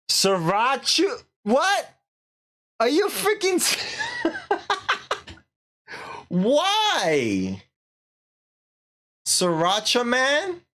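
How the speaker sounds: high, incredulous squeals; can't believe what she's reading; shocked disbelief. Real, not acted. Shocked by chat name.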